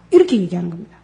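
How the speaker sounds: normal talking voice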